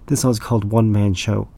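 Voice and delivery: ordinary delivery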